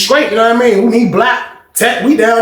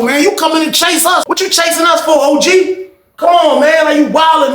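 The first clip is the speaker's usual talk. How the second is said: mock complaint; 8 semitones higher than usual. Confronting OG for following.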